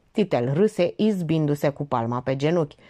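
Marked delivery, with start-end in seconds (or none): none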